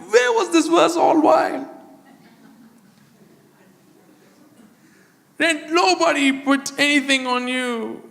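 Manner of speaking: high, loud preacher's cry; hammed-up shout of discovery; exclaiming outburst. Acted outburst after discovering the verse.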